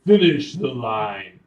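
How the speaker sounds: loud, slow booming command. Acted. Magical voice prompting the adventurer to answer, booming. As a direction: boom it out as an order. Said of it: "you hear a booming voice".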